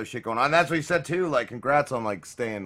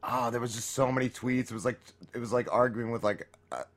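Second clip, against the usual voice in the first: ordinary delivery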